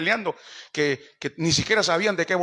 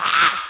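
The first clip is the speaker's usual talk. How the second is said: frustrated shout; 4 dB louder than usual. Acted. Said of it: "El "ah" es mío".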